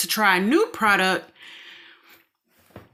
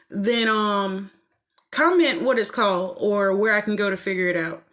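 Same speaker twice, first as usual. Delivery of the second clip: usual speaking voice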